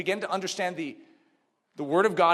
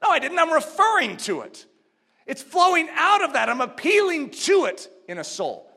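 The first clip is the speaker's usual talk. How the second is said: higher and louder, insisting; preached rebuttal; 6 semitones higher, 4 dB louder than usual. Acted. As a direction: push back hard on the objection. Rebutting an objector.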